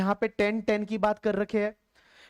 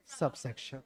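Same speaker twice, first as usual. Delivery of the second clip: soft answer cue; 8 semitones lower, 11 dB quieter than usual. Shown as a demonstration. Teacher answers own quiz question.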